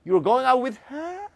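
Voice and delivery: high, slow disbelieving question; can't believe it; surprised question. Shown as a demonstration. Teacher demonstrating surprise about dating. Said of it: "놀람을 표현하고 있는 거죠".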